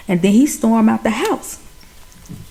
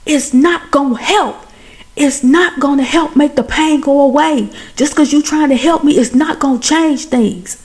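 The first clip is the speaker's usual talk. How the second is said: higher, emphatic acted protest; retold heated outburst; 5 semitones higher than usual. Chance to Michael mid-fight, heated protest. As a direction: drive each phrase home, pitch raised.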